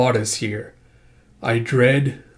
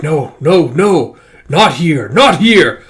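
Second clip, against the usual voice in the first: loud, rising alarmed repetitions; acted panic; 3 semitones higher, 8 dB louder, slower than usual. Storyteller as a character dreading the razor hound, acted panic. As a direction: build in alarm toward the last words.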